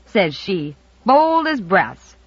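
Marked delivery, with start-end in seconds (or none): none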